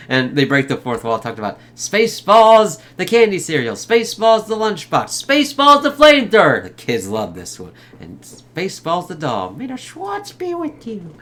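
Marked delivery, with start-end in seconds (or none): 1.8-6.6 higher, building hawker's pitch; mock ad pitch, getting higher; merchandise pitch. Rattling off movie merchandise pitch. 9.5-11.1 tiny, very high, soft doll voice; quiet mock falsetto catchphrase; toy doll voice. Voicing the Spaceballs doll.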